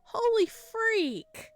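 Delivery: high, loud, slow outcry; stunned exclamation. Real, not acted. Player reacting to the dark twist, high and loud. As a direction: let the shock spill out.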